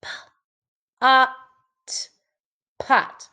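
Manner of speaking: "P, a, t" sound by sound, then "pot" blended. Shown as a demonstration. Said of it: "So let's tap it".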